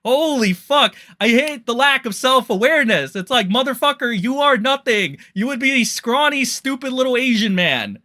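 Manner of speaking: loud, high ranting tirade; furious rant. Real, not acted. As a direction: tear into him at full volume. Heated rant at Grums.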